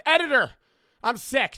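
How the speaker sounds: high, loud, pumped-up sales pitch; over-the-top promo read; hype merch ad. Acted. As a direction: sell the merch like a yelling hype man. Hypes his merch drop.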